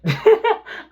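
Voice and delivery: sudden high loud laugh; high burst of laughter. Real, not acted. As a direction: break into a real laugh.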